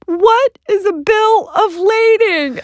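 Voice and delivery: high, slow, wobbly mock-sob question; a half-comic, high, slow tearful whimper; tearful plea. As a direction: wail it slowly like you're on the verge of tears. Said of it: "I just started crying".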